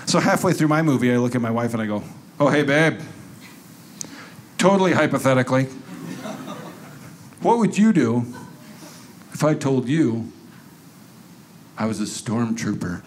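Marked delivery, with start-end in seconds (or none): 2.4-13.0 quick casual hypothetical that drops off at the end; mock innocent payback. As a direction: toss out the question offhand, then let it sink. He turns the trap back.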